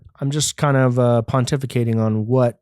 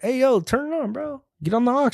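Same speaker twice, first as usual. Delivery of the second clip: much higher mock barber; 12 semitones higher than usual. Barber telling him to play it, high mock voice. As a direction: pitch it way up like the barber. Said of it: "the guy's like".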